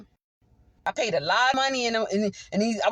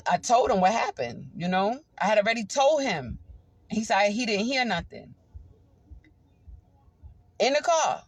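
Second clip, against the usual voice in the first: ordinary talk, nothing marked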